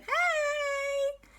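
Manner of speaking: very high squeal of greeting; children thrilled to see friends at the door; excited. Acted. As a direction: shriek it with delight. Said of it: "And they went".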